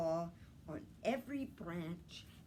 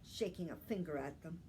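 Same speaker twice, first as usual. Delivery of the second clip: ordinary delivery, nothing marked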